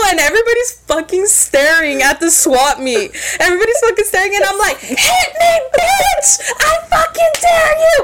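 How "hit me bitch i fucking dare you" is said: high yelled challenge, cracking into laughter; retold top-of-lungs yell. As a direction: scream the dare at her again, half laughing. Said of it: "I'm yelling at the top of my lungs".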